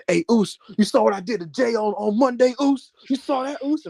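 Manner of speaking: high, animated wrestler imitation; excitable retelling of a wrestler's boast; Jimmy Uso. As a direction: pester your brother eagerly, pitched well up. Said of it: "he was doing this thing where he was like".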